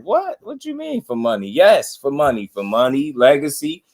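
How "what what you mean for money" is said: incredulous. Retold or imitated. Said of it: "I'm like".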